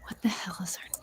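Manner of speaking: hushed aside under her breath; whispering. Real, not acted. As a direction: whisper it to yourself. Muttering to herself off-mic.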